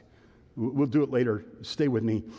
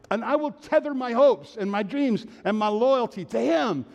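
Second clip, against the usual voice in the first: higher-pitched, emphatic declaration with drawn-out phrases; impassioned preaching; 7 semitones higher, 3 dB louder than usual. Real, not acted. Preacher declaring trust in God, impassioned. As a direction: preach it with rising conviction.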